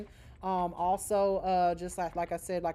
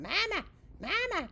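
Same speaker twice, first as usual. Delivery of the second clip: put-on high child's call of Mama, much higher; imitating a child; 12 semitones higher than usual. Kid running around salon calling mother, high childlike voice. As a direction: pipe up like a small kid calling mom. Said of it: "your little kid running around".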